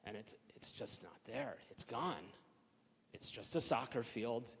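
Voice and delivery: near-whisper of loss; crushed and quiet. Real, not acted. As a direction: let the words sink, defeated. Sees field truly gone.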